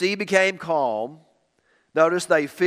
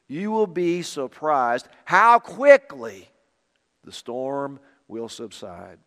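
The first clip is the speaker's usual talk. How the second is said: ordinary talk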